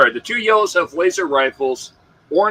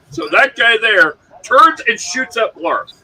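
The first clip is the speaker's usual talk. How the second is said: ordinary delivery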